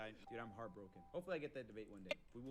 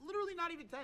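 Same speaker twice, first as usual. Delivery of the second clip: indignant; 8 dB louder, much faster than usual. Real, not acted. Indignant correction.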